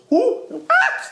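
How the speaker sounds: very high, loud squawk of surprise; shocked disbelief. Acted. Fan hearing his idols dismissed, hammed-up shocked disbelief. Acted shocked reaction to player.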